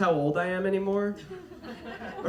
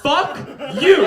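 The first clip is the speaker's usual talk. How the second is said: mock outraged; 6 semitones higher, 9 dB louder than usual. Reacting to the age guess.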